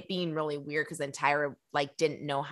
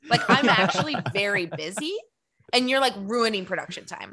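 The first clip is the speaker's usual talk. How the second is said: impatient diva; 4 semitones higher, 5 dB louder than usual. Retold or imitated. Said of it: "She's like".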